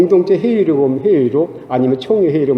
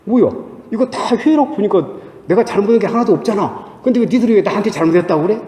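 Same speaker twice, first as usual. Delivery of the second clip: higher-pitched, mock-indignant complaint; indignant inner protest; 5 semitones higher than usual. Preacher replaying his anger at accusing brothers, indignant. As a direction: protest your innocence in a rising, hurried huff. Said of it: "안에서 막 화가 나지요".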